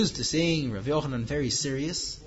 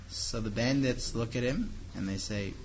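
ordinary talk, nothing marked

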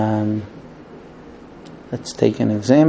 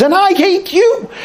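husband firing back. Retold or imitated. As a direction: throw it right back, pitched up. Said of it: "looks back at her and says".

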